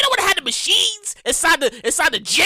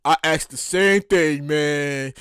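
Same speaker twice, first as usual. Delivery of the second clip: much lower, slow tired drawl; lower, slow deadpan poll worker; 10 semitones lower, much slower than usual. Retold or imitated. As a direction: sigh it out like a weary poll attendant. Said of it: "he was like".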